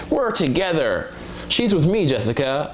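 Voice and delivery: high, insistent friend voice; protective girlfriend's friend. Acted. Friend fending off a guy hitting on Jessica, high and insistent. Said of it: "have a friend be like".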